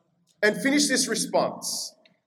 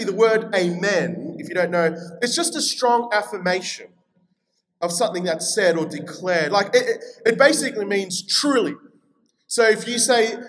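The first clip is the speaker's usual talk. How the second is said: normal talking voice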